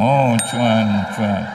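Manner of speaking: mock sighing prayer. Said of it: "Jadi cuan".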